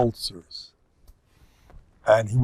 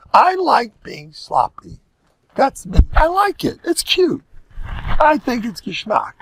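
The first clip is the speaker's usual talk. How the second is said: carefree homemaker voice; 10 semitones higher than usual. Retold or imitated. Voicing a sloppy homemaker.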